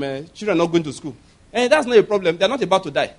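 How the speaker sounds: ordinary delivery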